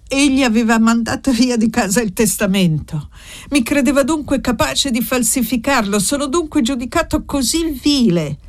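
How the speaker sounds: a son stung and wounded, pressing the priest; angry outburst. A narrator's reading. Paolo, hurt by the will, protests to the priest in rising anger. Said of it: "emise un'esclamazione di rabbia".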